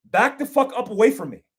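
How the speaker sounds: high, loud warning shout; commanding shout. Shown as a demonstration. Instructor shouting an armed robber back, loud command. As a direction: yell him back off you, hard and sharp. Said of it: "So if it was me, I would say".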